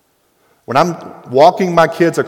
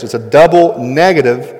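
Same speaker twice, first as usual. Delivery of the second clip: ordinary talk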